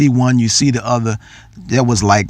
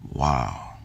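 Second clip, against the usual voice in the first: stunned murmur; 11 semitones lower, 12 dB quieter than usual. Real, not acted. Stunned by daughter's words.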